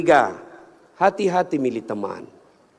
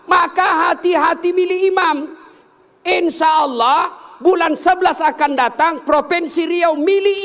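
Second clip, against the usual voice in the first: high, loud rallying cry; full-force preaching; 13 semitones higher, 5 dB louder than usual. Real, not acted. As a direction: thunder it out to the whole congregation. Preacher exhorts crowd at full force.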